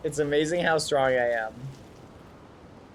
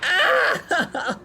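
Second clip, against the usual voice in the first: screaming while falling; 13 semitones higher, 3 dB louder than usual. Acted. Falls short.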